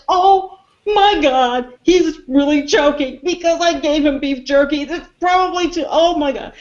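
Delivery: high-pitched mock panic; panicked alarm. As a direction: act out mounting alarm as someone chokes. Voicing Rudd panicking at choking.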